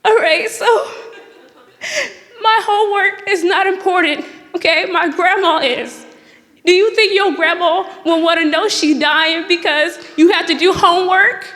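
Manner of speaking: half-laughing mock sob story; laughing through a pitch. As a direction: con the teacher while cracking up. Acting out guilt-tripping the teacher.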